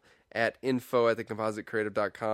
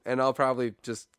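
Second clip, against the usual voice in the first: ordinary talk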